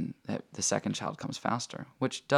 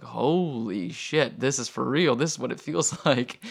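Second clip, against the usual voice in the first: retold shock; 3 semitones higher, 5 dB louder than usual. Wife realizing the contractions are real, loud retold shock. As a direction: imitate an overwhelmed outcry, stretching the first words.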